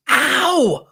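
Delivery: high, loud cry of pain. Retold or imitated. Mom yelping when daughter's knee hits hers. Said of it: "And I was like".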